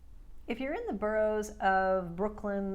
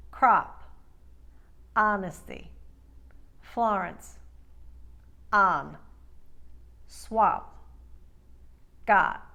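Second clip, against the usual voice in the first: Queens and Long Island wide ah; loud, careful Long Island word-list demo; Queens accent; 4 dB louder, slower than usual. Shown as a demonstration. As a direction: open each o wide, Queens style. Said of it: "Queens and Long Island second".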